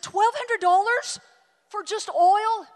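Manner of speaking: can't believe the price; shocked outrage. Retold or imitated.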